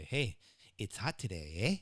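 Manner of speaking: mock Canadian with a tag eh; mock Canadian accent. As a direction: tease it in a Canadian twang. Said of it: "that's how we make fun of Canadians".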